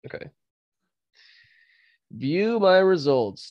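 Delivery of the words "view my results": mock announcement. Announcing quiz results.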